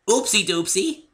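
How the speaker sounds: high, loud bubbly pony voice; squeaky cheerful Pinkie; playful pony chirp. A narrator's reading. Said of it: "said Pinkie".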